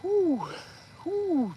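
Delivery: usual speaking voice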